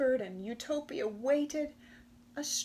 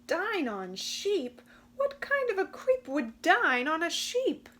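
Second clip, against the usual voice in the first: horrified question building up; alarmed disbelief; 7 semitones higher, 6 dB louder than usual. Acted. Utopia alarmed, realising the danger.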